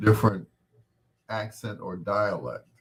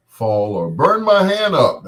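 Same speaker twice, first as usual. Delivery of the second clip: sudden loud, higher outburst after a laugh; joking yelp as if the torch burned; mock alarm; 6 semitones higher, 14 dB louder than usual. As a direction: burst out loudly for comic effect. Joking about dropping the torch.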